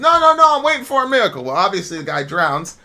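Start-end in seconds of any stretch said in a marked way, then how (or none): 0.0-1.4 high, loud breezy refusal; stubborn refusal. Retold or imitated. Stranded man turning down the log, high and stubborn. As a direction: wave off the rescue in a high voice.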